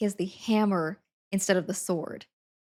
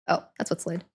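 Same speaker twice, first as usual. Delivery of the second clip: normal talking voice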